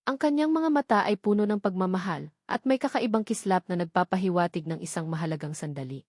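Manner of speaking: usual speaking voice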